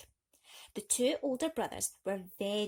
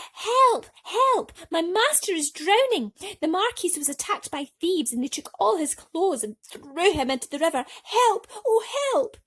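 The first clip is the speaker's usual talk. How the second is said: much higher, much louder frantic cries; shouting for help; 9 semitones higher, 11 dB louder than usual. Acted. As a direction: yell to stop the king's coach. Cat calls for help to coach.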